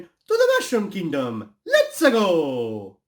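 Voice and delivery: high, drawn-out cartoon battle cry; Mario catchphrase. Shown as a demonstration. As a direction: shout it as a gleeful rallying call. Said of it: "it really should have been".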